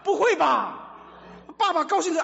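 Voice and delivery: shouted rhetorical question, high; loud disbelief. Real, not acted. Preacher challenging the congregation, loud and high. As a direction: throw it out loudly, incredulous.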